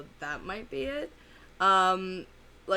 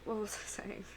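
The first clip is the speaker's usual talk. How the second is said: whisper to self; 3 semitones higher, 8 dB quieter than usual. Real, not acted. Forgetful aside to herself.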